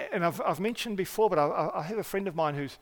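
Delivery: normal talking voice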